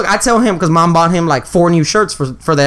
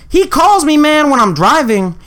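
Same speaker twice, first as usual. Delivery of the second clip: usual speaking voice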